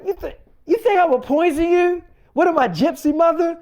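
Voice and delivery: high, loud, indignant squawk; mock outrage at the accusation; offended disbelief. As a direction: girlfriend playing up how insulted she is. Girlfriend offended at poison accusation.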